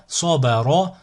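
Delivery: syllables joined as one word. Shown as a demonstration. Teacher models a word for learners to repeat, joined in one go. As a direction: run the syllables together without pauses. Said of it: "le mot attaché".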